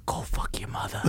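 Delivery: hissed whisper right in her face; menacing whisper. Retold or imitated. Man threatening the old lady up close, venomous whisper. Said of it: "And I got in real close".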